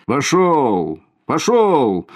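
loud, high clearing-the-crowd shout; policeman shouting. A narrator's reading. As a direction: bark at the crowd to move.

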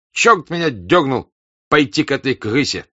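loud bitter curse; angry curse. A narrator's reading. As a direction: curse yourself in anger. Denisov curses loss.

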